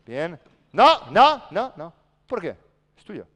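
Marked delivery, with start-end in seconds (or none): 0.7-1.9 high, very loud no; sharp real coaching shout; shouted correction. Real, not acted. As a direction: yell to stop the mistake at once. Coach stops a mistake.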